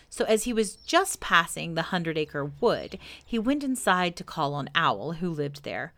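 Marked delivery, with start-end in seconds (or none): none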